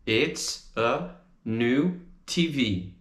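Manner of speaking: slow, careful It's a new T V; slow model. Shown as a demonstration. Teacher drilling the phrase slowly, then normal. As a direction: pace it out for learners. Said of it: "a gente diz é uma nova T V".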